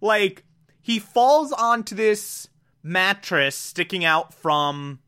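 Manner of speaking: ordinary delivery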